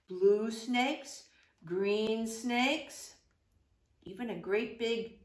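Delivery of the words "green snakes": ordinary delivery, nothing marked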